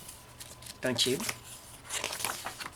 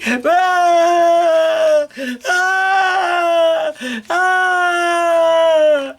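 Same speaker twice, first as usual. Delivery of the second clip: long, loud high-pitched wails; baby yelling; 7 dB louder, much slower than usual. Acted. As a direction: scream like a baby at full blast. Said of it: "yelling as loud as she could".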